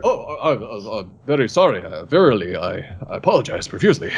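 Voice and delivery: low, stumbling, hammed-up contrition; mock-formal apology. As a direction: drop the voice and apologize like a courtly offender. Acted contrite apology after the outburst.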